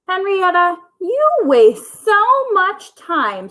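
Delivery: high, slow squawking hen. Acted. Aunt hen scolding Henrietta for reading, high slow squawk. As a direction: stretch it out like a scolding old hen. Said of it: "squawked Aunt Golda".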